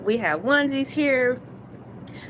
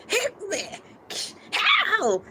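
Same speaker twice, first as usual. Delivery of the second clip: mock whimper; 10 semitones higher than usual. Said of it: "It's like".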